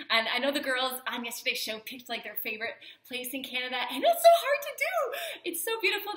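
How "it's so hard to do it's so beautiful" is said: exclaiming. Real, not acted. Emphatic about choosing.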